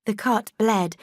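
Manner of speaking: usual speaking voice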